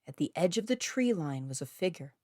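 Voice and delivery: ordinary delivery, nothing marked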